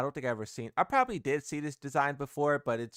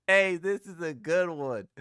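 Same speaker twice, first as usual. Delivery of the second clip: "Hey, this is a good one" said louder and slow, laughing through it; laughing delight; 2 semitones higher, 5 dB louder than usual. Real, not acted. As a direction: savour the joke out loud, slowly. Laughing at a funny meme.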